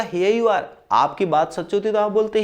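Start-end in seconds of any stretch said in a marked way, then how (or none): 0.0-0.6 higher triumphant "Here you are", pitch lifted on "you". Pretend. The self-declared god crowing to the crowd, triumphant. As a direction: call it out like you just proved it. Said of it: "तो मैंने बोला".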